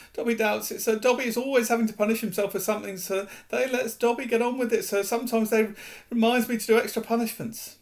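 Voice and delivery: high, fast elf voice, matter of fact; shrugging off punishments; house elf. Acted.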